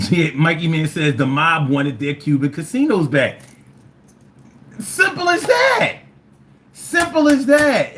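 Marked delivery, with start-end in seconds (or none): 4.8-7.9 higher, slow shouted punchline; emphatic shout. Real, not acted. Comic to audience, slow loud emphasis. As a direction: hammer it home, shouting it out slowly.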